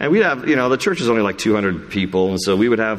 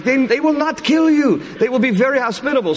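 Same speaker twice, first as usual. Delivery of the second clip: much higher, lively imitation of a friend; imitating Daniel; 3 dB louder than usual. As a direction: pitch it way up to mimic Daniel inviting you. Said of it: "He's like".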